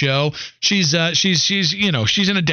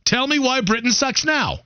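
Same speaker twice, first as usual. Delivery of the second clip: pressing challenge; 7 semitones higher than usual. Real, not acted. Host pressing cohost in mock debate.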